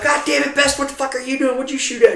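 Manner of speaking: high, exasperated shout; angry yell. Retold or imitated. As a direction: demand an answer from him. Yelling at soldier Best.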